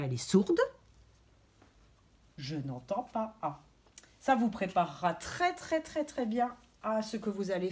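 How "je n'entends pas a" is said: quiet, low «Je n'entends pas A»; soft negative. Shown as a demonstration. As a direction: drop it down softly. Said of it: "l'oreille est barrée, elle n'entend plus rien". (voix basse et douce)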